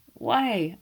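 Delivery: a sudden loud, outraged why; cry of protest. A narrator's reading. Hastings protests loudly to Poirot. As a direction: burst out in protest. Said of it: "I cried".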